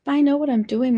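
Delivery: snapping back. A narrator's reading. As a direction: snap it back in anger. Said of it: "I snapped".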